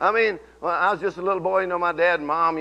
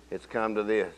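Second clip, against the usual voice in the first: quiet; 8 semitones lower, 8 dB quieter than usual. Shown as a demonstration. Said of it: "Look at somebody and say".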